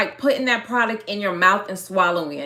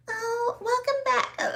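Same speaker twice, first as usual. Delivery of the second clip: high, soft and slow put-on sweetness; imitating a fake, airy livestream welcome; fake greeting; 13 semitones higher, 6 dB quieter, slower than usual. Woman mocking her old livestream greeting, fake. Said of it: "it was just so fake".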